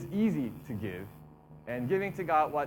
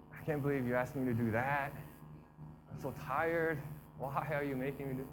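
mock weary moan, softer; tired complaining; 3 semitones lower, 7 dB quieter than usual. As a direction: moan like a reluctant, worn-out worshipper. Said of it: "an attitude of".

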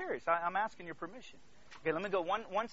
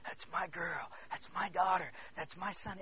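hushed, starting in a near whisper; tender fatherly pride; 3 semitones lower, 6 dB quieter than usual. Acted. God as father owning his children, softly proud. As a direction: murmur it like a proud dad.

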